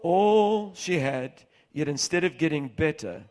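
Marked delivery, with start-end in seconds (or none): 0.0-1.4 "all" stretched slowly and louder; drawn-out all. Shown as a demonstration. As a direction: draw out "all she had" slowly. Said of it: "say all".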